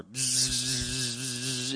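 soft low bee buzz, drawn out; buzzing bee sound. Shown as a demonstration. Pastor imitating grumblers as buzzing bees, soft bzzz. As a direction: hum bzzz like a swarm. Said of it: "Sounds like the buzzing of bees".

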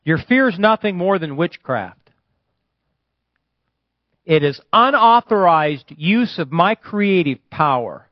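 high, loud declaimed word; prophetic proclamation. Real, not acted. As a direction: deliver it as a message from God.

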